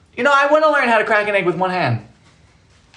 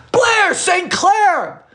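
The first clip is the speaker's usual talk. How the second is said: high, drawn-out cry of the name; excited shout; 13 semitones higher, much slower than usual. Real, not acted. Greeting a famous viewer in the stream chat, starstruck, drawn-out shout. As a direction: call out the name like a starstruck fan.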